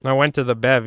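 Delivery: usual speaking voice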